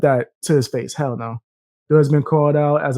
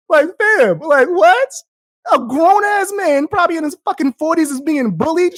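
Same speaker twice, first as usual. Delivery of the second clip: incredulous; 11 semitones higher than usual. Real, not acted. Streamer ranting at chat.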